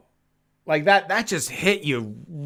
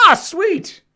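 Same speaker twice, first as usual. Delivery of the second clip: excited; 5 dB louder than usual. Real, not acted. Good jelly bean flavor.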